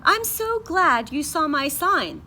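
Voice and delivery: high, loud chirp; chirpy bird. Acted. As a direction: chirp it brightly like a little bird. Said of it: "the bird chirped".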